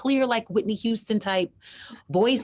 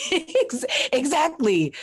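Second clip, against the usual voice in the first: laughing; 8 semitones higher, 3 dB louder, slower than usual. Real, not acted. Laughing agreement.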